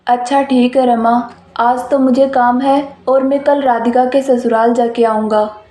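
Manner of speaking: a dismissive husband, spoken low; father's voice. Acted.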